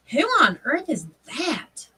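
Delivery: slow, high, swooping question; mock bewilderment. Retelling seeing a strange car pull up, puzzled. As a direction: drag it out in baffled disbelief.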